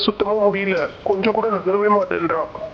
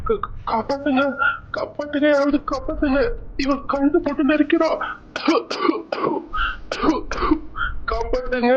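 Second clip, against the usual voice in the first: choking cry for help; 5 semitones higher than usual. Acted. Groom being strangled cries help.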